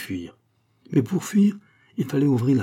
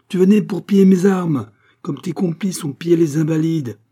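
high, loud, cutting him off; furious accusation; 8 semitones higher, 9 dB louder than usual. Acted. Abbé accusing Pitou of looting, loud fury. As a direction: snap over his words.

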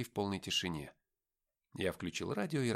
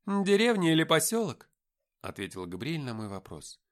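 higher, louder, assured angel speaking; angel Gabriel's voice; 8 semitones higher, 9 dB louder than usual. Acted. Angel defending Lucifer to the men, high and loud. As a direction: speak as a proud, certain angel.

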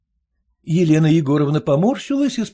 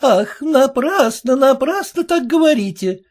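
offended; 9 semitones higher, 4 dB louder than usual. A narrator's reading. Peddler woman offended by the daughter, with hurt pride. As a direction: take it personally, mildly hurt. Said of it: "с обидой в голосе сказала торговка".

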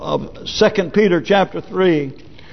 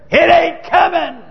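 high-pitched defiant holler; scoffing call; 12 semitones higher, 3 dB louder than usual. Acted. Men of the earth calling up to God, scoffing holler. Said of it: "calling up to him saying".

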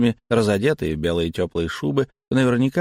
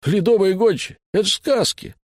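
much higher indignant scoff; 10 semitones higher than usual. Acted. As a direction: scoff at the fairy tale. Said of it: "возмутился Пузо". (возмущённо, с насмешкой)

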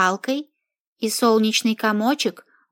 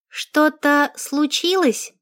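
innocent; 5 semitones higher, slower than usual. Acted. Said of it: "Невинно похлопала глазками".

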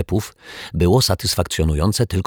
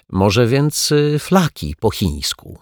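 nervous; 6 semitones higher, slower than usual. A narrator's reading. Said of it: "zapytał nerwowo kelner".

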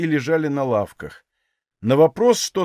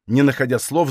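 ordinary delivery

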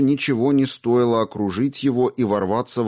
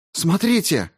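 alarmed cry; 9 semitones higher than usual. Acted. Radko urgently shows the earring.

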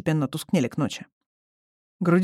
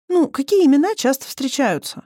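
higher and louder, impatient asking; 9 semitones higher, 5 dB louder than usual. Acted. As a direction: push the question, out of patience. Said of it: "нетерпеливо пояснила Алиса". (нетерпеливо, громче и выше)

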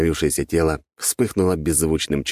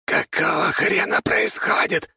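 jaw-clacking shock, high; stunned skeleton; 13 semitones higher, slower than usual. A narrator's reading. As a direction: gasp it out in disbelief. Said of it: "ошарашенно защёлкал челюстью".

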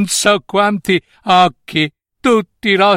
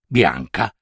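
ordinary delivery, nothing marked

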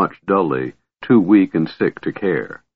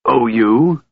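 strangled voice; 4 semitones higher than usual. A narrator's reading. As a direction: force "Owe you?" out through a tight throat. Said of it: "he repeated in a strangled voice".

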